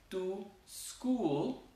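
"to school" split, with school starting on its own S; high demo "to; separated words. Shown as a demonstration. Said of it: "en vez de decir".

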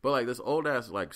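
ordinary talk, nothing marked

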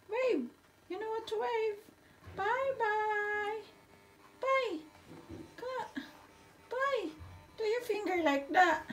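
high, soft coaxing. Real, not acted. Coaxing the child to wave.

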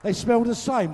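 cracking up mid-sentence at the joke; laughing. Real, not acted.

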